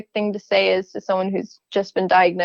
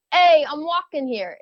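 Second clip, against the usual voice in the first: Brooklyn accent; 5 semitones higher than usual. Shown as a demonstration. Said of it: "Give me your best Brooklyn accent".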